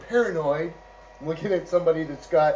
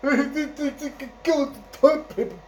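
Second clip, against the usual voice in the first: mocking laugh; 10 semitones higher than usual.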